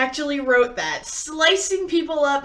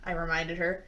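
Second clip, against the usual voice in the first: ordinary delivery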